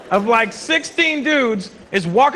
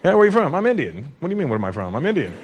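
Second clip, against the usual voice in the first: lower, deadpan back-and-forth with a hint of a questioner; lightly voiced dialogue; 9 semitones lower, faster than usual. Comic replaying questions about his origin, deadpan. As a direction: flatly insist on the same answer. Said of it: "I'm always like".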